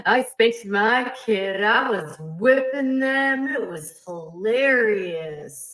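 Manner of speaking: slow, drawn-out mock preacher voice; a drawled send-up of a gloating preacher; mocking Gil Bates. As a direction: drag it out, mocking his glee. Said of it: "He'll be like".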